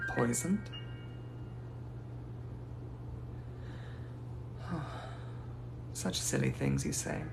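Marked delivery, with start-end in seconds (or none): 0.7-5.9 long unvoiced weary sigh; long soft sigh at being accused. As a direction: let out a long drawn breath, offended. Long sigh at poison suggestion.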